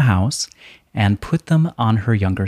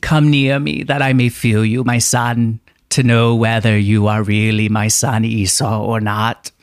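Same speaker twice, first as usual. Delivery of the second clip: Isaac voice. Acted. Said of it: "Yeah, your Isaac voice".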